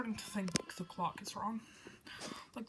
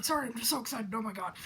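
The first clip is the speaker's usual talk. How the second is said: ordinary talk, nothing marked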